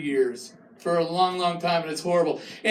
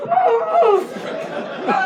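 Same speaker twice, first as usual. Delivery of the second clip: very high, loud strained yelps; hammed-up cries of pain; 4 dB louder than usual. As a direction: cry out like your back is locked. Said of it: "just going".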